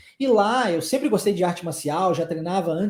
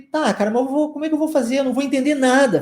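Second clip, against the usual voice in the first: higher, louder retold protest; exasperated, pitched-up mock complaint; baffled objection; 6 semitones higher, 4 dB louder, much faster than usual. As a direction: replay a flustered objection to a friend. Retells his own baffled objection.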